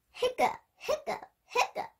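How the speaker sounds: high breathy hiccup noises; mock hiccups. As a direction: squeak out the hiccups. Hiccups acted.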